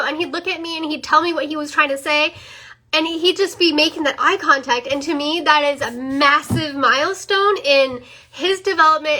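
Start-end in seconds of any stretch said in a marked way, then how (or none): none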